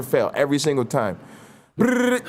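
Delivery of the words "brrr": high trilled phone ring "brrr"; imitated phone ring. Phone ringing before grandma picks up, high trill. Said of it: "that's how they, that's how they talk".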